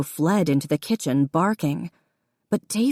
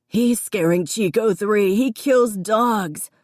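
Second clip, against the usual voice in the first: hysterical accusation; 4 semitones higher, slower than usual. A narrator's reading. Davy accusing Connor, hysterical. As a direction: scream it at Connor.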